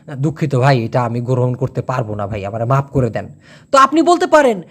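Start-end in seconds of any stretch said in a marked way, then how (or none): 0.1-3.3 a humble, soft-spoken no to a brother; soft polite refusal. Shown as a demonstration. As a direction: lower and softer, gently apologetic. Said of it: "একটু নরম সুরে বলেন".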